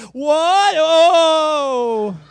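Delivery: very loud, high, long-held wail; huge theatrical shriek of disbelief; mock shock. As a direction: cry out in hammed-up outrage, stretching the last sound. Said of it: "and you say".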